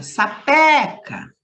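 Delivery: "sapeca" with PE high and punched; stress on PE. Shown as a demonstration. Teacher showing pupils the tonic syllable, stressed PE in "sapeca". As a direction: hit the middle PE of "sapeca" hard. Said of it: "eu pronunciei o pé com mais forte".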